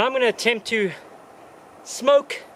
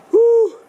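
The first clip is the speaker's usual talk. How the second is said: a loud, high celebratory woo; real victory whoop; triumphant whoop; 7 dB louder than usual. Real, not acted. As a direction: whoop it out in relief at the top.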